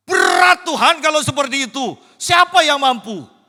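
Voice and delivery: high, loud exasperated outcry; dismayed protest. Retold or imitated. Preacher voicing the disciples complaining to the Lord, loud dismayed outcry. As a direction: protest that it's too hard.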